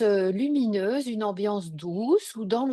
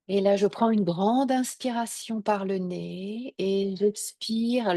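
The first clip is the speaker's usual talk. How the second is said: guided meditation. Acted. Ma douce voix.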